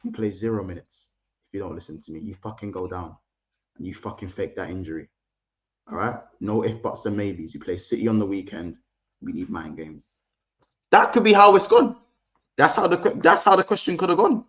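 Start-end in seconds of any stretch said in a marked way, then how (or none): 0.0-10.1 low, hushed menacing orders, much softer; Arteta voice. Retold or imitated. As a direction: lean in and threaten quietly like a scheming manager. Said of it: "I said".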